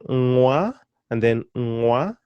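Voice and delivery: "ngwa" with a long hummed ng, no hard g; hanging consonant. Shown as a demonstration. Teacher showing her tongue position, hanging consonant held. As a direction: hold the ng, then glide into wa in "ngwa". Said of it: "they are hanging consonants".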